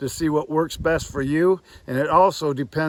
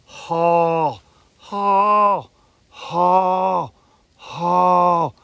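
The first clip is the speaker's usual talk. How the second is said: loud throaty haw sounds, louder; goose moan; 3 semitones higher, 6 dB louder, much slower than usual. Shown as a demonstration. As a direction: push a loud open-throated haw from the bottom. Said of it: "So what I'm gonna do on my moan is".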